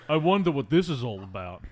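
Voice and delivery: light Stallone meathead voice; meathead impression. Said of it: "that's my meathead impression".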